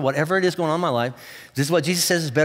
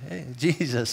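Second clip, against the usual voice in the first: shy greeting; 4 semitones lower, 6 dB quieter than usual. Retold or imitated. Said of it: "and like".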